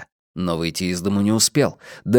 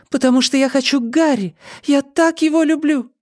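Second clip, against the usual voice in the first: put-on very high girlish pleading; high-pitched lovesick girl; girl's voice. A narrator's reading. Girl tells mother of her love.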